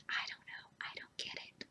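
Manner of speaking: faint unvoiced whisper. Real, not acted. Repeating her bafflement to viewers in a whisper. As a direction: whisper it to yourself. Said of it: "whispered repeat".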